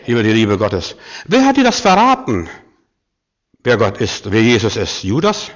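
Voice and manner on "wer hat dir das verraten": urgent high-pitched challenge; high insistent question. Real, not acted. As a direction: pitch leaping far up.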